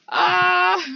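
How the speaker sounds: usual speaking voice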